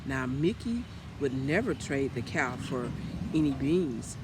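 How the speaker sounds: ordinary delivery, nothing marked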